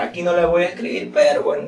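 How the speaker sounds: ordinary talk, nothing marked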